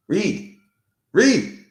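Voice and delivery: short high-pitched shouts for attention; calling out. Real, not acted. Calls out, getting louder.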